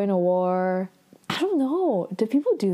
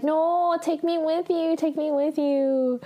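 imitated whining; 7 semitones higher than usual. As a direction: whine it like someone begging to come along. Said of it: "He's, like, whining".

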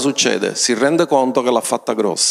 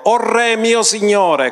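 a high, slow call across the distance, moderately raised; 9 semitones higher, slower than usual. Retold or imitated. David calling out to King Saul, high and slow. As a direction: reach out to the king respectfully. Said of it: "gridò a Saul dicendo".

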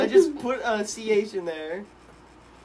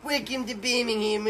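mock British accent; 6 semitones higher than usual. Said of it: "That's my British accent".

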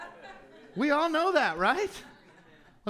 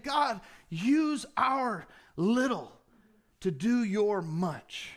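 slow, deliberate plea; slow prayer; 2 semitones lower, slower than usual. Acted. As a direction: pray each word slowly and deliberately. Congregation's prayer voiced.

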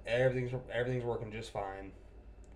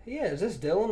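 higher, louder imitated caller; lightly voiced customer on the phone, pitched up; 9 semitones higher, 6 dB louder than usual. Customer calls technician back, imitated in a higher voice.

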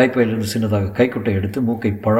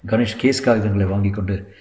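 ordinary delivery

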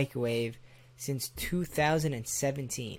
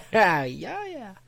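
normal talking voice

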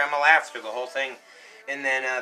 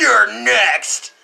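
loud, raised scare-actor voice; imitated menacing threat; 7 semitones higher, 8 dB louder than usual. Storyteller as the guy looking at him, loud menace. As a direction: threaten like a haunted house monster.

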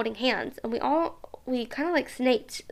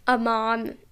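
ordinary talk, nothing marked

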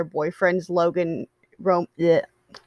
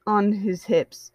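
plain reading voice

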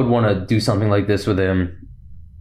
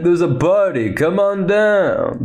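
high, drawn-out party invitation; Lando; 7 semitones higher, slower than usual. Acted. Lando inviting everyone to the party, high playful call. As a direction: call them over in a playful put-on voice. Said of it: "He's like".

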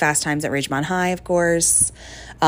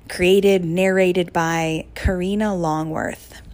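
normal talking voice

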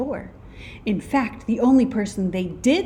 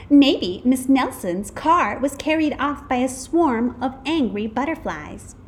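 lightly voiced know-it-all kid; a hint of an eager child, starting higher; 6 semitones higher, 5 dB louder than usual. A narrator's reading. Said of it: "exclaimed one know-it-all".

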